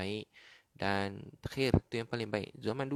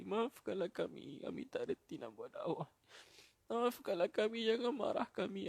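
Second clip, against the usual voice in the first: tearful wailing prayer; 10 semitones higher than usual. Real, not acted. Preacher begs God's forgiveness for failing at dakwah, tearful wail. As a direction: wail it out, begging for mercy.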